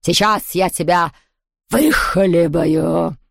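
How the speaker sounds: slow, predatory ghost threat; drawn-out villainess menace; menacing hiss. A narrator's reading. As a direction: linger hungrily over the last word. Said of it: "прошипела она".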